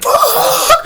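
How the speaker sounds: high strangled curse with a laugh. Retold or imitated. Hiker cursing when his truck won't start. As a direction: curse in panic.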